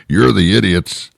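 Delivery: usual speaking voice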